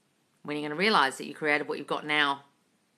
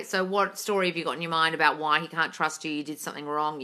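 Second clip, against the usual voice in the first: ordinary talk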